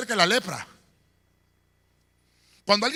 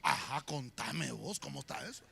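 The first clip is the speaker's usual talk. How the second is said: gossip's hush; 8 semitones lower, 15 dB quieter than usual. Retold or imitated. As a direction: lean in for gossip. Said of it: "la gente en vez de apartarse". (chismoso en voz baja y lenta)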